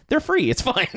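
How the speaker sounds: high, breezy throwaway; airy mock-casual quote, pitched high; mock carefree escapee. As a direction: toss it off like nothing matters. Escapee dismissive quote, pitch jump.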